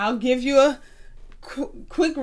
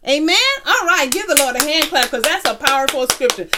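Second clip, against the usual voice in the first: rousing call; 2 semitones higher, 5 dB louder, faster than usual. Real, not acted. Calls class to clap for Lord.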